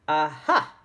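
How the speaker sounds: loud knowing aha in an odd voice; Dame Snap aha. Acted. Old schoolmistress catching the children, loud aha. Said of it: "I make a good Dame Snap voice".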